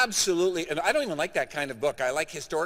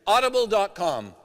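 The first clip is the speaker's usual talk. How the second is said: announcing the site; 4 semitones higher, 7 dB louder than usual. Real, not acted. Announcing the website to audience.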